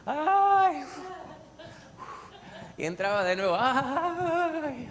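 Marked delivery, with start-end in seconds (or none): 0.1-0.8 very high, loud "ay" cry, imitating; mock groaning wail. 3.6-4.9 very high, loud "ay" cry, imitating; mock groaning wail.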